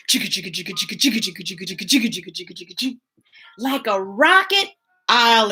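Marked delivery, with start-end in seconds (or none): 0.1-3.0 soft low chugging train noise; train chugga. Pretend. Host voicing the train for children, soft chugging. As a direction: chug like a little train. Imitating train sound in book.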